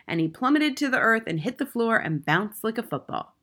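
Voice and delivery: ordinary delivery, nothing marked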